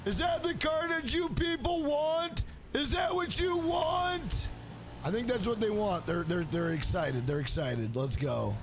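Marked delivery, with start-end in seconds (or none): 0.1-4.5 high, slow shout to the crowd; taunting arena showman yell; hammed-up gladiator shout. Playing to the cheering crowd.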